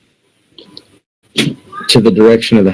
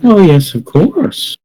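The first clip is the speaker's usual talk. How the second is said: higher-pitched, lilting assurance; creepy pastry seller; 7 semitones higher than usual. Acted. Demon woman answering questions about the boy, creepily assuring.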